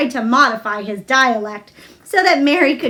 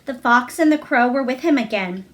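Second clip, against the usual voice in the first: usual speaking voice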